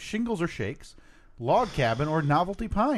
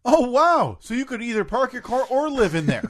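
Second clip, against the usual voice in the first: sarcastic; 9 semitones higher, 6 dB louder than usual. Pretend. Mocking garage door listing.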